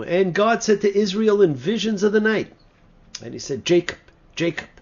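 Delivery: loud, high proclaiming of the verse; declaiming scripture. Acted. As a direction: ring out the angel's call from heaven.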